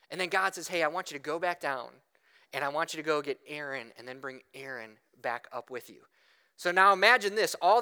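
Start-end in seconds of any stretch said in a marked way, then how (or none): 0.7-6.1 low, matter-of-fact instruction, softening at the end; a hint of a low divine voice; lightly voiced God. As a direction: give God's orders calmly, sinking lower. God's instructions to Moses, voiced.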